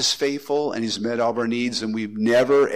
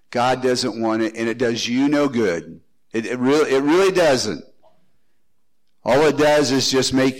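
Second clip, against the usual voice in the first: normal talking voice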